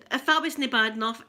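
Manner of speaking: usual speaking voice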